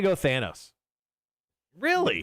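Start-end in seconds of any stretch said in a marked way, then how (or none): 1.8-2.2 surprised. Real, not acted. Surprised at own pick.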